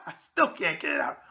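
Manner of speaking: normal talking voice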